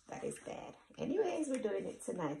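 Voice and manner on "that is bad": whispered aside. Real, not acted. Admitting guilt to child.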